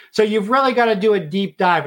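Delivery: usual speaking voice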